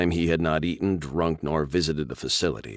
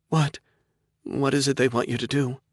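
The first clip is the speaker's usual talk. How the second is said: raised, startled and confused; young woman caught off guard; 9 semitones higher than usual. Acted. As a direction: ask it high and bewildered. Sarah confused, alarmed.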